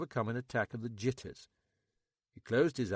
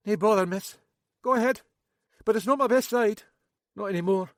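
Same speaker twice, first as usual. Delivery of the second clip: higher, louder nervous local man; Max; 11 semitones higher, 10 dB louder, faster than usual. A narrator's reading. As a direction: play Max high and jittery. Max voiced.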